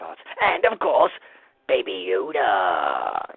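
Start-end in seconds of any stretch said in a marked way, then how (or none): 1.7-3.3 drawn out low and slow, savouring the name; gushing. Acted. Fan gushing over Baby Yoda, slow and low. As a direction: stretch Baby Yoda lovingly.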